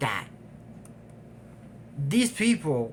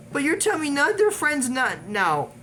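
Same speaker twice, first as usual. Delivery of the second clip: incredulous; 11 semitones higher, 5 dB louder than usual. Real, not acted. Incredulous at friends not noticing.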